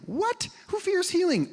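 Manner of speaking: high, surprised question; high incredulous squeak; mock disbelief. Real, not acted. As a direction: act astonished at the idea.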